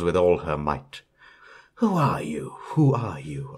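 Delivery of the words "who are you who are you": faint, airy elf voices circling her; lightly voiced mischievous elves; a breathy hint of elves; eerie elves asking. A narrator's reading. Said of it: "ask the elves".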